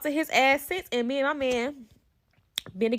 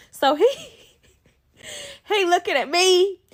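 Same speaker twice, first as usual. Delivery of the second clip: breaking into laughter mid-sentence, then higher; laughing; 7 semitones higher, 4 dB louder than usual. Real, not acted. Storyteller recounting the awkward standoff, laughing. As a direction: crack up, then push on high-pitched.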